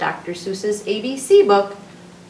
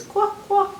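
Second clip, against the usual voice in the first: high, small "wock" quacks; baby duck quack; 12 semitones higher than usual. Acted. Reader showing what the duckling says, baby duck quack. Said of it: "So he says".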